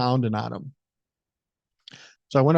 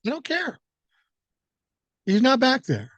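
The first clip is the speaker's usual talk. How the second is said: high-pitched, insistent brush-off; retold exasperation; 10 semitones higher than usual. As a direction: push the pitch up, mock exasperated. Retold argument at the door.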